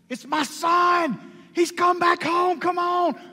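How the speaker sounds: high, loud cry of a father overjoyed; joyful shout. Acted. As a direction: shout it out as the son comes into view. Father sees son return home.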